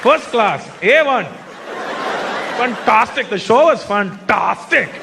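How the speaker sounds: put-on punchy compliments in an Indian accent; emphatic Indian accent. Indian fan rating the show, emphatic Indian accent. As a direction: praise like an overawed Indian fan, each phrase set apart. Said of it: "emphatic with their compliments".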